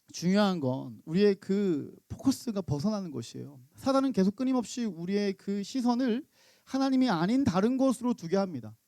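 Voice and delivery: ordinary delivery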